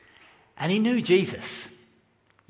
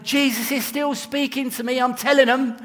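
higher-pitched, fervent retold testimony; excited testimony; 7 semitones higher than usual. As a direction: imitate a friend eagerly insisting on her faith. Retold friend waving Bible in hospital.

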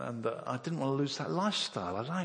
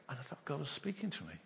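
ordinary delivery

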